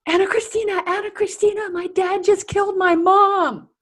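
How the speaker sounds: put-on high, loud panicked cry of a young girl; frantic teen girl. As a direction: voice the terrified girl calling your name. Panicked girl voiced in story.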